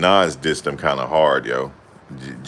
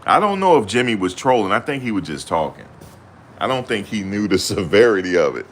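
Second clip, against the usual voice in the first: normal talking voice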